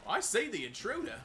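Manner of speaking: put-on high, loud Cockney accent. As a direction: quote it in a broad Cockney accent. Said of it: "The Cockney accent of".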